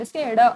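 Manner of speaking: ड said loudly and clearly; letter demo. Shown as a demonstration. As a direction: make the letter sound ring out. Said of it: "ये होता है ढ और ये होता है ड".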